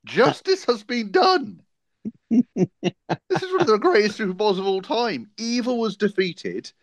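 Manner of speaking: high-pitched, triumphant crowing; ecstatic gloating. Real, not acted. Seahawks fan celebrating the win, high and jubilant. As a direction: proclaim victory in giddy high voice.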